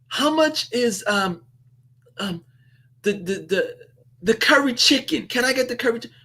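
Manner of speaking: high, hesitant voice of a nervous first-timer; unsure newcomer fumbling through an order; timid confused customer. Retold or imitated. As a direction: stammer out the order like you don't know the menu. New customer unsure how to order.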